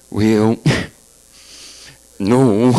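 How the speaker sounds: folksy presidential pause before no; Reagan impression. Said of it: "Ronald Reagan was a great communicator".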